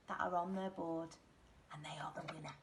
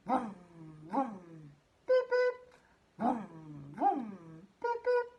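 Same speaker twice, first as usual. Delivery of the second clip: car noise imitation; 3 semitones lower, 3 dB louder than usual. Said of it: "Let's hear it again".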